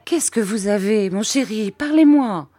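high urgent plea, building to a loud cry; mounting alarm, peaking on the last words. A narrator's reading. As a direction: beg the child to speak. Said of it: "cria Peggotty en me saisissant".

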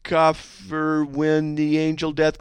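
loud, high, drawn-out delivery; another wrong reading. Shown as a demonstration. Host tries a death threat another wrong way, loud high drawl. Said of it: "Or you could try it like this".

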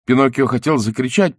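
ordinary delivery

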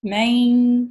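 "من" held with the ن hidden in the nose; ghunna. Shown as a demonstration. Teacher shows the hidden noon, "من" hummed through the nose. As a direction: stretch "من" into a nasal hum, no clear n. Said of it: "وہ میرے ناک میں چھپی".

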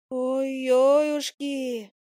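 high, slow wailing little fly. Acted. Fly wails over what fell from the doorframe, high slow lament. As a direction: wail in dismay like a tiny fly. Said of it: "запричитала мушка".

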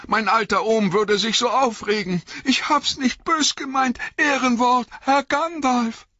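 very high, panicky begging; frantic, high-pitched acted fright; trembling plea. A narrator's reading. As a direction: beg for mercy like a terrified servant. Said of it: "rief Sam bebend".

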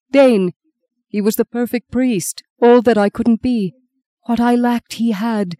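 grief rising into a break. A narrator's reading. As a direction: let the voice crack with grief. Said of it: "His voice broke".

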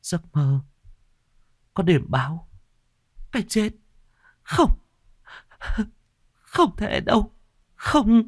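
high, halting terrified babble; a girl stammering in panic. A narrator's reading. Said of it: "hoảng hốt mà thành ra ăn nói lắp bắp". (hoảng loạn lắp bắp)

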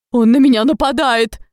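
panicked squeal; shrieking. A narrator's reading. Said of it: "Фред взвизгнул".

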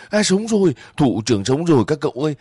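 high, fast burst of joy; relieved joyful cry. A narrator's reading. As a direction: shout the good news to the others. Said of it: "những người đang khóc lại cười toá ra".

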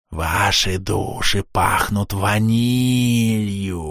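slow, gurgling spectral voice; gurgling ghost. Acted. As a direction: bubble the words out like a drowned spirit. The gurgling ghost speaks again.

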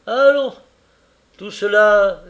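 loud, high-pitched cheery call; brisk exclamation. Acted. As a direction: jump up with bustling energy. Caleb rising from work.

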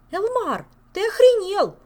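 furious high yell. Acted. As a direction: scream it at him. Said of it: "заорала Ольга".

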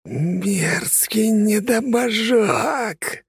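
high, slow, drawn-out monstrous shout; sinister voice. Acted. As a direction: roar it like a hateful demon. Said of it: "раздался зловещий голос".

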